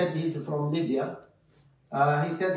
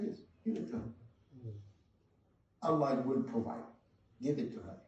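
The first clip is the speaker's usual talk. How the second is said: whispered, much softer resolve; hushed; 3 semitones lower, 9 dB quieter, much faster than usual. Retold or imitated. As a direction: give it away in a near whisper. Father gives away his son's grave.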